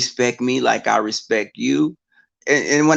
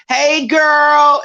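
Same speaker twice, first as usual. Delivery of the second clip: mock girlfriend greeting; 13 semitones higher, 6 dB louder than usual. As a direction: sing out "hey, girl" like an overfamiliar acquaintance. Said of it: "they be like".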